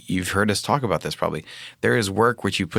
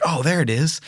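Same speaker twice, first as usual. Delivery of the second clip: higher-pitched eureka moment; mildly excited retold discovery; sudden realization; 9 semitones higher, 3 dB louder than usual. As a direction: relive the aha moment.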